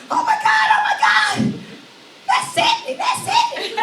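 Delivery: very high, squealing fan; excited fan squeal. Retold or imitated. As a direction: shriek it like a starstruck fan. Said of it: "I hear somebody say".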